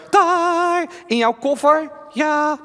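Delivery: high, scared puppet voice; nervous ventriloquist doll; talking doll. Acted. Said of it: "Henk is mijn pratende pop".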